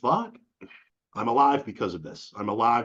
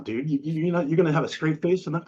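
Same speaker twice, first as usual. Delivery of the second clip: usual speaking voice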